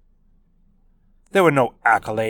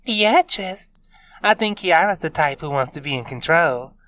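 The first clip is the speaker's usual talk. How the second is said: teasing; 7 semitones higher, 3 dB louder than usual. A narrator's reading. Taylor teasing.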